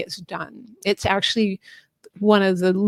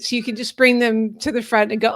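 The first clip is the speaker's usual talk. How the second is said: ordinary talk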